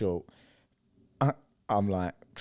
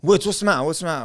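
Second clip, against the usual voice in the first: retold shout; 8 semitones higher, 5 dB louder, much faster than usual. Waking drunk mate, retold.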